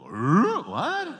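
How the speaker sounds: loud, high-pitched puzzled cartoon dog; baffled yelp leaping up in pitch; Scooby-Doo. Two men baffled by the wife's outburst, cartoon-dog confusion. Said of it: "Scooby-Doo".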